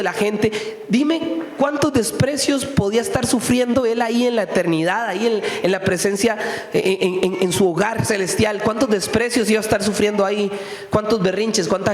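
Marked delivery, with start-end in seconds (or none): none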